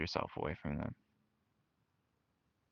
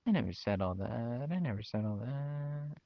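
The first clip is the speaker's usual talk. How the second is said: higher, indignant denial; a hint of a defensive mom, pitched up; lightly mocked mother, high and protesting; mocking mom; 6 semitones higher than usual. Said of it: "she's like".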